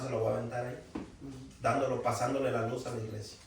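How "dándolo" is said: normal talking voice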